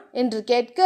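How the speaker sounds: plain reading voice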